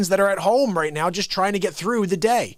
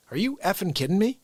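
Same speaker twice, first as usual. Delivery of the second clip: usual speaking voice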